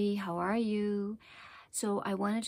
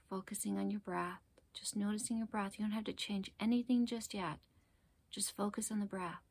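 guided meditation; 4 dB quieter than usual. Acted. Teacher guiding listeners to focus on the breath, calm meditation voice. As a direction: ease them gently into their breath, unhurried.

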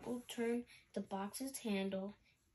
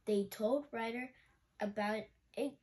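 usual speaking voice

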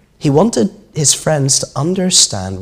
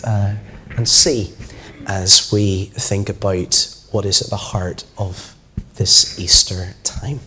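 ordinary delivery

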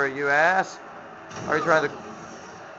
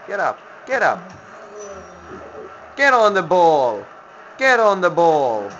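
urgent urging; 3 semitones higher than usual. Real, not acted. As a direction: urge them on, insistent. Urging the minion in game.

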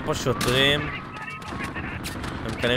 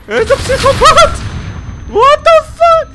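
very high, loud disbelieving yells; shrieking what-the-hell panic; shocked shouting; 9 dB louder, slower than usual. Real, not acted. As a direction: shout it out in outrage. Enemy rushes him screaming.

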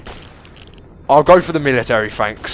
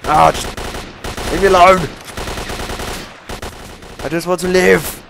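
desperate plea; 6 semitones higher than usual. Real, not acted. Begging enemies to leave him.

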